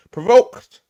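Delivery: loud high sarcastic blurt. Real, not acted. Streamer mocking the provocation claim, loud sarcastic blurt. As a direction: fling the word out mockingly.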